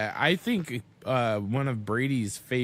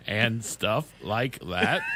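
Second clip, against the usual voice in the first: slow, flat robot voice; 2 semitones lower, slower than usual. Retold or imitated. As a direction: read it like a stiff machine. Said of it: "robot voice where it's all like".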